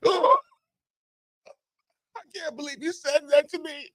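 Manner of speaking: high, quick, hammed-up wail; mock hurt. Player stung by coach's remark, mock hurt wail. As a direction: whimper in falsetto as if stabbed by words.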